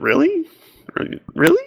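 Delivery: very high-pitched questioning squeak, mock incredulous; skeptical disbelief. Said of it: "raised an eyebrow and went".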